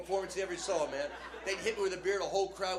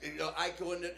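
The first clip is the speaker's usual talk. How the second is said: usual speaking voice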